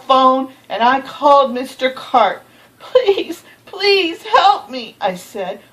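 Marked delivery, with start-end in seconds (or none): 2.8-4.9 pleading. Acted. Boy begs Mister Carp.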